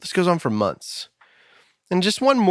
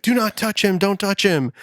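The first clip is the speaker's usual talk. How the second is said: raised, higher-pitched retold yell of an EMT; mock scream; 7 semitones higher than usual. EMT ordering the church crowd back, mild mock yell. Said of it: "he screams at everybody".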